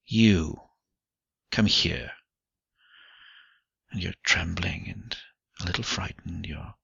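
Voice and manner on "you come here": understated command. A narrator's reading. Man summons the frightened woman, commanding. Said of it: "His voice commands you".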